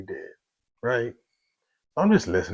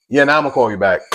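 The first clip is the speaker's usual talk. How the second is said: shouting, cutting her off hard; loud retort; 5 semitones higher, 13 dB louder, faster than usual. Retold or imitated. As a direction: snap back loud and final. Angry retort to friend on phone.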